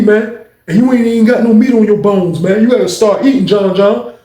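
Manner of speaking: high, loud ribbing; teasing big man. Retold or imitated. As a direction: tease him to eat up. [name] teases and urges eating.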